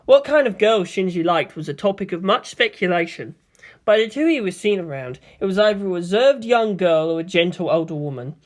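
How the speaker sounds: usual speaking voice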